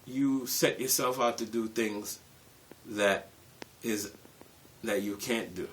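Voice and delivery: usual speaking voice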